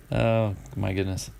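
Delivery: normal talking voice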